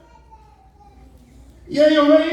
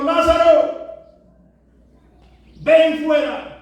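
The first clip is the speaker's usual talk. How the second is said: raised, louder voice of summons; commanding call; 4 semitones higher, 5 dB louder, slower than usual. Acted. Preacher as Jesus calling Lazarus out, commanding call. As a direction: call into the tomb with authority. Jesus calls the dead man out.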